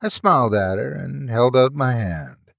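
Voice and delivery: ordinary delivery, nothing marked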